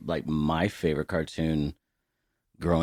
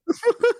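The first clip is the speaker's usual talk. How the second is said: a sudden squealing laugh, much higher and louder; high-pitched burst of laughter; 9 dB louder than usual. Real, not acted. Cracking up while retelling the prank call, squealing laugh.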